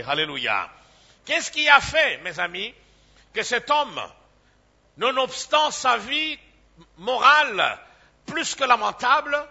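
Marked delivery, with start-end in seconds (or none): 1.2-9.4 high, loud pulpit question; preacher challenging his flock about a sinner's grace; fiery preaching. Acted. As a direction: hammer the question at the congregation. Rhetorical question at full force.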